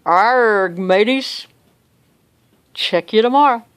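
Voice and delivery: playful pirate sign-off. Acted. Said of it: "in my best pirate voice".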